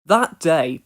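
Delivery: usual speaking voice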